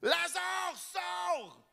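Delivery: high ringing command held back from the mic; strong voice. Acted. Preacher repeats Jesus's call, high but held back from the mic. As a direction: call out without blasting the mic. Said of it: "J'ai pris une voix forte, mais je ne vous ai pas pété les oreilles".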